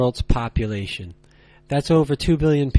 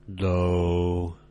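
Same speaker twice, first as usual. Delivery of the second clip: "low," held deep, lower and flat; low level tone; 6 semitones lower than usual. Shown as a demonstration. Teacher showing learners the third tone, low and flat. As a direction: drop "low," to the bottom and hold it. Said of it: "And one more time".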